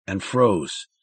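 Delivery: ordinary delivery, nothing marked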